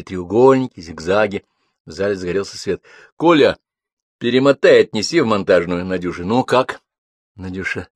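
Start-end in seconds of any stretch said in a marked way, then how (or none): none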